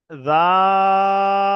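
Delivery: জাল with জা held very long and loud; drawn-out zal. Shown as a demonstration. Teacher showing the right জাল to students. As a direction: pull the জা out, then land on ল. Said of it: "জালটাও তাই".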